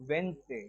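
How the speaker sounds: whisper-close WENZ-day, no d; teacher giving the right answer; right pronunciation. Shown as a demonstration. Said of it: "right answer".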